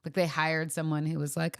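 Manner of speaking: ordinary talk, nothing marked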